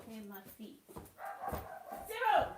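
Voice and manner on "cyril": calling the dog. Real, not acted. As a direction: shout him off the guinea pigs. Going to fetch barking dog.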